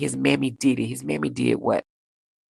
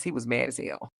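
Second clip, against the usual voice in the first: ordinary talk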